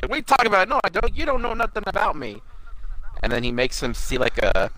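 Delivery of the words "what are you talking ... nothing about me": higher, defensive little imp voice; imitating Impmon. Impmon denying he likes humans, mock defensive. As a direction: snap back defensively, pitched up. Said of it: "And Imon's like".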